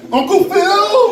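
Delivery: high, drawn-out, loud call; excited tourist shout. Acted. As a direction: squeal the name like a star-struck fan. German tourists spot their TV favorite.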